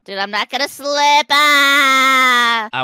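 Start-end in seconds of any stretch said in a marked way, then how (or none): 1.3-2.8 long, loud mock scream; sustained yell of someone dropping away; falling scream. Falls right after boasting.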